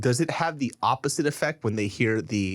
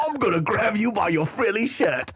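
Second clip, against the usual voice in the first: higher comic pirate threat; cartoon British pirate, pitched up; mock British accent; 6 semitones higher than usual. Continues British pirate riff.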